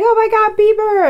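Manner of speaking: high, loud fangirl squeal. Retold or imitated. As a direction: squeal over [name]. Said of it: "he's like".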